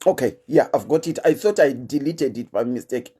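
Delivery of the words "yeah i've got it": ordinary delivery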